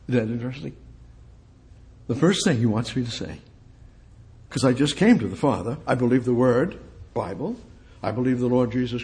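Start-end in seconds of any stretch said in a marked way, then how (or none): none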